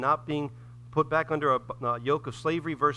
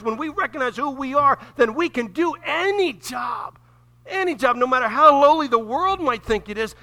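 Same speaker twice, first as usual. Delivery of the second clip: rising, loud preaching; full-force preaching; 7 semitones higher, 6 dB louder than usual. Acted. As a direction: preach it out, building louder toward a shout.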